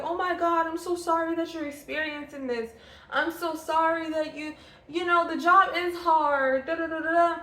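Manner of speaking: a hammed-up pitying colleague; mock sympathetic coworker. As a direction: play the coworker gushing fake concern. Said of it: "I was like".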